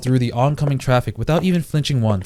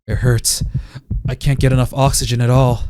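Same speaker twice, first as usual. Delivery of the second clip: ordinary delivery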